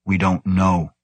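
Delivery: ordinary delivery, nothing marked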